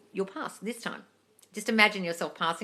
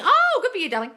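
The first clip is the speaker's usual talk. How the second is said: excited congratulations; 12 semitones higher, 8 dB louder than usual. Real, not acted. Reacting to follower's good news.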